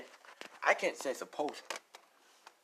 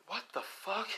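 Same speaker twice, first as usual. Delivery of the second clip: shocked; 8 semitones higher, 8 dB quieter than usual. Acted. Second startled reaction to roar.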